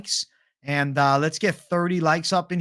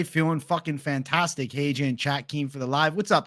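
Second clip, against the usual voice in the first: ordinary talk, nothing marked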